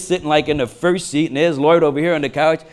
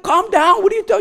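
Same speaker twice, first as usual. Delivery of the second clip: high-pitched, bewildered pleading; retold exasperation. Husband trying to settle his panicking wife, exasperated.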